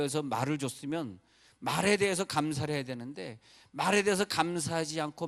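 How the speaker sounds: fervent pulpit exhortation; full-force preaching. Acted. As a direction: preach it out to wake the sleepy. Preaching on gratitude.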